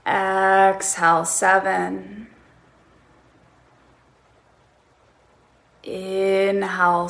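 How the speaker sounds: calm, drawn-out breath counts; slow breath counting. Shown as a demonstration. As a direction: count each breath slowly. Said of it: "So counting in this way".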